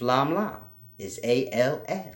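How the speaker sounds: ordinary delivery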